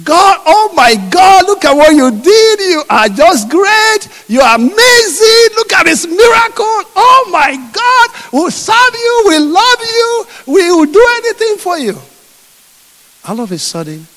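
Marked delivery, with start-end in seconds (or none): 0.1-12.0 high, loud, jubilant praise shouting; ecstatic praise. Acted. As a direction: cry out thanks to God after the sea. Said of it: "they started singing".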